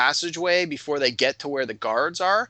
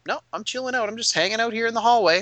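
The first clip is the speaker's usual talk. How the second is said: high-pitched breezy player voice; nonchalant refusal; 8 semitones higher than usual. Retold or imitated. Drow player staying back in the hallway, nonchalant. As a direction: brush it off in a raised voice.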